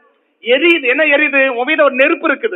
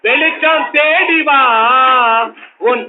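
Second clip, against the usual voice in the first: very slow, drawn-out chant; slow prophetic invitation; much slower than usual. Acted. Preacher inviting the afflicted to come and believe, slow chant. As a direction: call them gently toward the light.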